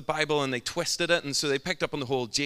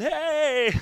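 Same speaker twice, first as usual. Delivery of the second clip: very high, loud mock greeting; drawn-out goofy falsetto call; spaced-out enthusiast; 5 dB louder than usual. Man on something greets a stranger across crowd, in falsetto.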